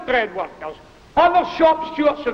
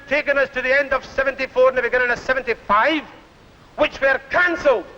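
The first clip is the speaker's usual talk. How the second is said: ordinary talk, nothing marked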